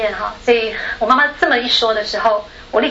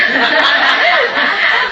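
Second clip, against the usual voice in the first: high laugh; 10 semitones higher, 5 dB louder than usual. Real, not acted. Laughs retelling mom's reaction.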